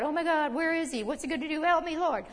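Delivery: high-pitched, hurried worried freak-out; mock panic. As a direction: imitate a believer panicking and pleading. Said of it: "worried and freak out".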